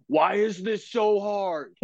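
high, drawn-out wail of despair; exasperated cry. Retold or imitated. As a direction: cry it slowly up to the heavens. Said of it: "look straight up in the air and go".

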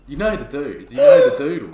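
laughing. Real, not acted. Laughing emphatic bit.

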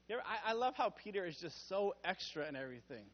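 ordinary talk

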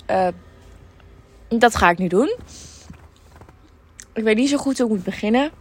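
ordinary delivery

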